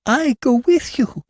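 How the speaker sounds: high, giddy cry; giggling cry. A narrator's reading. Levantine to the fat man, high giddy cry. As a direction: blurt it out, giddy with glee. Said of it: "giggled and cried".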